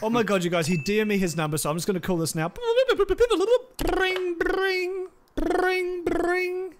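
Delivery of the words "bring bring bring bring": phone ring imitation.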